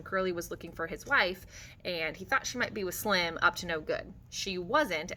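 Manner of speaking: ordinary delivery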